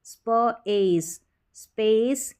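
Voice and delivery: स्प, pause, एस, then joined as स्पेस; very slowly "spuh... ace", then स्पेस whole; blending by parts. Shown as a demonstration. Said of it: "स्प पढ़ेंगे".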